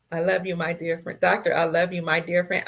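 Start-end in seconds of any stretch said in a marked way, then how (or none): none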